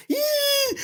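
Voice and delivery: very high squeal; joking high-pitched yelp. Pretend. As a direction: shriek it playfully. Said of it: "Let's talk Portuguese".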